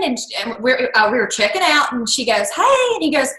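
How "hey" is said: very high imitated hello; chirpy greeting. Sales manager greeting him back at checkout, chirpy. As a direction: sing out a quick friendly reply.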